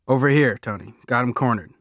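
calling out. A narrator's reading. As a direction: holler over to your boss. Gang punk calls out to his leader.

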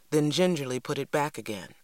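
plain reading voice

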